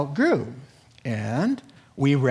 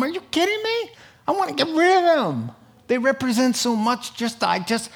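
high mock outrage; 11 semitones higher than usual. Friend refusing to sell albums, high incredulous squeal. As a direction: voice jumps way up in mock disbelief. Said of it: "Somebody said".

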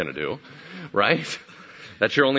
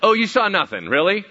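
higher, disbelieving challenge; incredulous; 9 semitones higher, 6 dB louder than usual. Acted. Left-behind disciple doubting the returning three, incredulous. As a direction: throw their denial back at them. Bartholomew disbelieves their denial.

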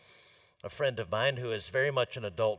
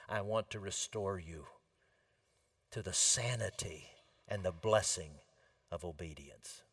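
tender invitation; 7 semitones lower, 9 dB quieter than usual. Pretend. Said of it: "He opens his arms to you".

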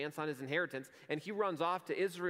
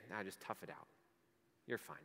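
low, much softer brush-off; dismissive God; 6 semitones lower, 10 dB quieter than usual. Pretend. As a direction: shrug it off coldly and quietly.

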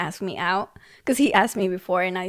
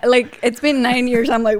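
ordinary delivery

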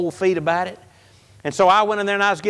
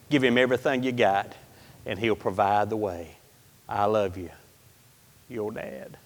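much softer and lower, hushed and gentle; tender father's letter; 9 semitones lower, 7 dB quieter than usual. Retold or imitated. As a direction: read a dead father's love to his son. Reading his late father's letter.

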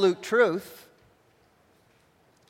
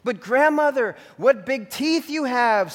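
slightly quivering Red; 7 semitones higher, 5 dB louder than usual. A narrator's reading. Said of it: "her voice quivering slightly".

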